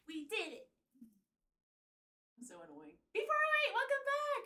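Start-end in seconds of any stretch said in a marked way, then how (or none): 3.1-4.4 high, loud streamer welcome; hype greeting. Real, not acted. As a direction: shout the welcome like a hype host.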